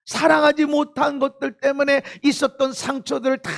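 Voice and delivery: loud, high-pitched preaching; forceful preaching. Real, not acted.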